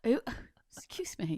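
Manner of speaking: whispered, offended aside; mock offended whisper. Mother whispering her shock at her son's boast, offended. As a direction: hush it as if scandalised. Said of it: "I was just like".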